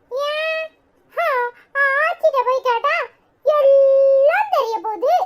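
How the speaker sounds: put-on high, childlike chatter of a curious little kid; little kid asking about the baby; child voice. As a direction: play a small child pestering the family.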